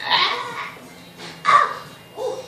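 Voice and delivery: ordinary delivery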